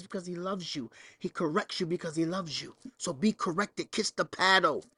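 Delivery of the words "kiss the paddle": normal talking voice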